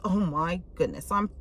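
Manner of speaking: ordinary delivery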